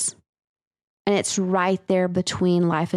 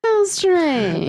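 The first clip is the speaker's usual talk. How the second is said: high theatrical how strange; mock spooky voice; 9 semitones higher, 3 dB louder than usual. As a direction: ham up the eerie wonder.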